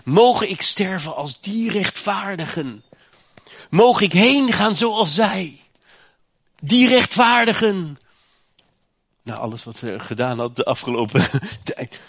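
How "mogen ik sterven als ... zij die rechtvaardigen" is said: loud, high proclaiming recitation; preaching oracle. Acted. Preacher proclaims Biljam's blessing to the congregation, loud and high. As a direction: declaim the prophecy with force.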